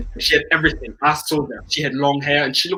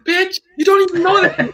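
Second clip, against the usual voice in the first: high-pitched, loud indignant squeal; hammed-up jilted outburst; mock outrage; 14 semitones higher, 5 dB louder than usual. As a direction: shriek it in comic disbelief at being ditched. Acts his outraged retort to the girl.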